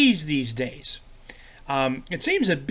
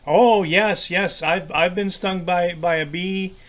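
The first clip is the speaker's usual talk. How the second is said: ordinary talk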